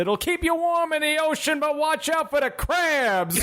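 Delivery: high, slow, shouted comic drawl; Dice Clay impression. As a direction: ham up a sleazy comic. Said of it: "Lead scientist Dice Clay responded".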